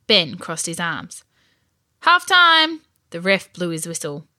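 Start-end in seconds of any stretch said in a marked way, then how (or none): 2.0-2.8 high, loud drawn call. A narrator's reading. Referee calls the break to both teams, calling out. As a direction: announce it across the pitch.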